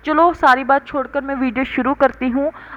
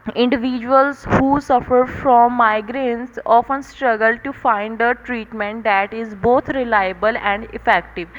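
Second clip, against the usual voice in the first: slow, measured, even reading; steady even tone; much slower than usual. Shown as a demonstration. Tutor demonstrates the right exam tone, slow and steady. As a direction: read it calmly and evenly, word by word. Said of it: "अब ये मेरी tone है".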